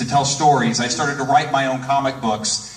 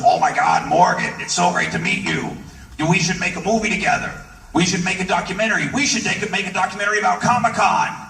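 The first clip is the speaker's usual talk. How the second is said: excited quote, climbing higher and faster; Stan Lee; 4 semitones higher than usual. Retold or imitated. Stan pitches a Comic-Con film to a fan, excited. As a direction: pitch the idea with mounting enthusiasm. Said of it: "And Stan goes".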